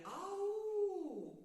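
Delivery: high, distant mock call for help; a lost girl calling out from far away; Masha calling in the forest. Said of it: "Хтось гукає".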